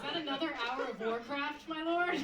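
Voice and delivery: loud mock deference, title pitched high; sarcastic. Wife nagging husband about his gaming, sarcastic. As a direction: needle him, lifting the final title sharply. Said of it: "it would be rife with sarcasm".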